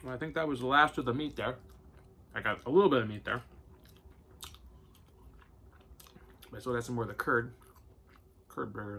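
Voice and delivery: talking with mouth full; eating between words; chewing. Real, not acted. Seen 0: Bites burger repeatedly, licks fingers, chews between phrases throughout.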